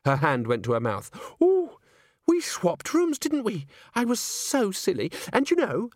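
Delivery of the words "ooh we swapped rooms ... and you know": put-on high, fluttery girlish chatter; bubbly cheer. A narrator's reading. Christine remembers the room swap with Agnes, bubbly. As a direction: start on a high squeal and prattle on. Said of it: "boiling over with cheerfulness".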